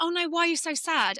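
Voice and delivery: high-pitched mock concern; imitating upset child. Son asks his mum why she is sad, upset. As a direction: voice a worried child, pitched well up. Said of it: "And he gets so upset".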